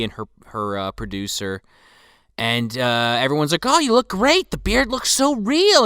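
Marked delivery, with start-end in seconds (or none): 3.7-5.8 high, eager praise; gushing. Retold or imitated. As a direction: gush over him. Crew gushing over Santa.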